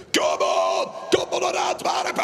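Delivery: booming hype cry to the audience; rallying shout. Pretend. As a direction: yell it like a city chant.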